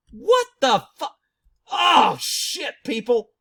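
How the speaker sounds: high loud angry outburst; outraged shout. Real, not acted. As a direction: blow up in disbelief. Outraged at hotline money.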